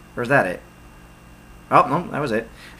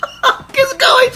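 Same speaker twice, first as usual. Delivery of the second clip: very high disbelieving cry; shocked cry. Real, not acted. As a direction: yelp it in disbelief. Reacts to dolphin flying.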